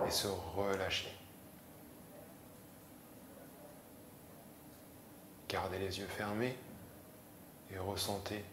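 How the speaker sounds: soothing yoga nidra voice; calm instruction, fading into long pauses; guided meditation. Acted. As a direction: guide them gently into release. Leads guided relaxation.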